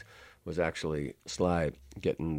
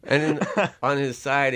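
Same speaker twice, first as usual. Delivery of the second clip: talking through laughter; laughing; 9 semitones higher, 9 dB louder, slower than usual. Real, not acted. Laughing while telling.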